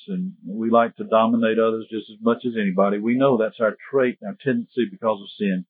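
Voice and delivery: low-key, subdued pastoral prayer. Real, not acted. Pastor asking the Lord to help the congregation, subdued prayer. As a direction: plead humbly with God, letting phrases drop soft and low.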